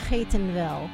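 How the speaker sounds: normal talking voice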